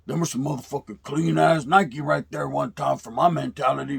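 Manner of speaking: a hint of an older homeboy's slang; Charlie Boy's talk. Charlie Boy eyeing the new Nikes, lightly imitated homeboy. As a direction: lightly impersonate the neighborhood bully. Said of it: "This is how he talked".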